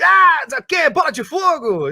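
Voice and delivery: put-on high excited teen shout; teen Fred yell. Shown as a demonstration. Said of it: "aí você dá aqueles gritos".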